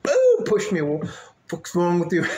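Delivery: high, loud indignant yelp; annoyed outburst. Retold or imitated. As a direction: squawk at me like I'm crazy. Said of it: "And he'll be like".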